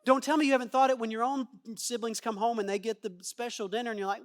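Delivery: ordinary delivery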